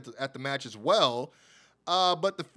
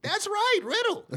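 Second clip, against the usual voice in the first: much higher, hyped reveal; excited reveal; 4 dB louder than usual. Acted. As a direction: shout out the surprise return, higher and louder. Hyping Riddle's surprise return.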